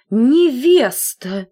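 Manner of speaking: stunned by the news; dismayed exclaim. A narrator's reading. Collar stunned that the comb is engaged, drawn-out. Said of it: "воскликнул воротничок".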